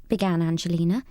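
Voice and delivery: ordinary delivery, nothing marked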